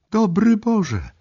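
very high, slow shocked cry; shocked exclamation. Acted. Nicole watching the attack, a high slow shocked cry. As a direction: gasp it out in horror. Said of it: "wykrzyknęła Nicole".

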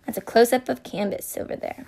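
ordinary delivery

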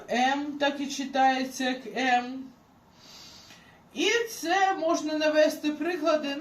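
usual speaking voice

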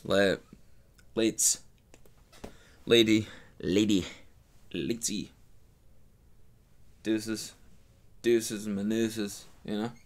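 mock-cool, drawn-out goodbye; cool sign-off. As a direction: play it too cool for school. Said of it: "sign off in, like, a cool way".

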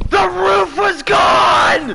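high, slow, loud disbelief; outraged shout. Real, not acted. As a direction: yell it out in outrage. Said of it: "Sorry, I didn't mean to yell".

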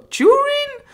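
high, excited squeal of the name. Real, not acted. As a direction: pitch her name way up with delight. Gushing introducing Jurin.

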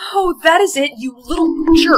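loud, fed-up scolding; angry outburst. Acted. Fed-up soulmate snapping at the prankster who dressed them up, angry outburst. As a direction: raise your voice, exasperated.

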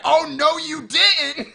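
loud, high-pitched indignant cry; mock outrage. Woman to the dragon eating her horse, in mock outrage.

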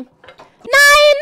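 high, loud cry of no; alarmed shout. Real, not acted. Streamer seeing something about to fall, alarmed shout. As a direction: yell it out in alarm. Startled scream during the game.

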